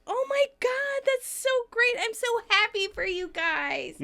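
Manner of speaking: very high, gushing squeal; hammed-up thrilled congratulations; excited. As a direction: gush with over-the-top delight. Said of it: "And Rachel gets excited".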